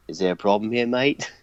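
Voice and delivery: put-on Australian accent; mock Australian accent, like a cartoon character; [name]. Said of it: "I just sounded like Rocco".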